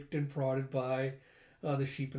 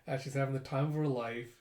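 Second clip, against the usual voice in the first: normal talking voice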